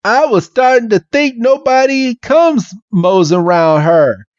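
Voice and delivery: best attempt at a Southern accent; Bill. Bill greeting his unexpected rescuer, Southern accent. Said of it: "this Southern accent".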